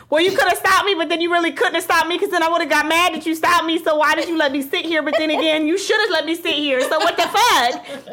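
high-pitched argumentative rant, acted; mad. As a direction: argue in circles with mock exasperation. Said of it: "So you're mad that I'm calm?".

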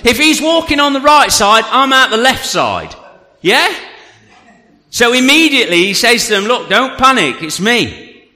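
high-pitched, energetic sermon storytelling; animated preaching. Real, not acted. Preacher telling the church how Jesus walked out, animated. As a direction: preach it with drive and punch.

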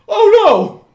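high, loud panicked nebbish voice; a jittery ghost yelping in alarm; nervous ghost. Acted. Ghost to the players, high and loud, panicking. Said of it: "He looks around, he's like".

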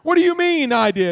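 a father getting madder; angry dad. Retold or imitated. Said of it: "that even made my dad madder".

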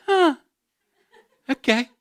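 high, bewildered, half-laughing; baffled tourist who can't follow; confused alarm. Retold or imitated. A tourist lost abroad voiced in bewildered alarm.

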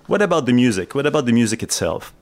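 ordinary talk, nothing marked

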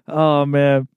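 ordinary delivery

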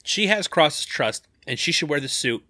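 ordinary delivery

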